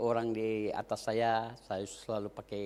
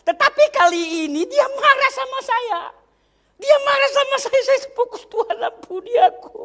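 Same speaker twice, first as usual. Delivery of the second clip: loud, high, impassioned sermon voice; fervent preaching; 19 dB louder than usual. Acted. Preacher confessing to the congregation how rejection hurt, preaching at full force. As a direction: preach it out, pouring your heart into the testimony.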